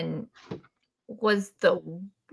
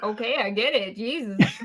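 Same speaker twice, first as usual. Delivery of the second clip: usual speaking voice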